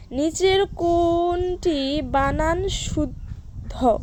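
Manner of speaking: very slow, vowels stretched long; drawn-out question reading. Shown as a demonstration. Teacher reading a quiz question to students, slowly drawn out.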